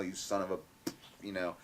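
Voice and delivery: normal talking voice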